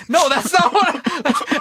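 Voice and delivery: high, giggling denial; can barely get the words out for laughing; laughing protest. Retold or imitated. Chev denying it while the teller laughs.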